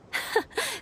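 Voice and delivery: a short, high, soft mock-villain chuckle; a playful cackle after vowing revenge; mock evil laugh. The knight teasing about revenge on the bartender, laughing softly.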